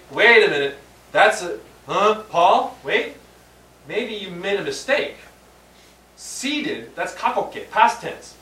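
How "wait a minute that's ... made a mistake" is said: louder, higher mock protest; startled listener, wait, that can't be right; incredulous objection. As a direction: voice the doubter catching a mistake. Imagined listener objecting to Paul.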